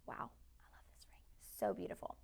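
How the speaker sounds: breathy whisper of delight; soft murmured wow over a ring; whispered aside. Real, not acted. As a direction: whisper it to yourself, admiring the ring. Aside while admiring the ring.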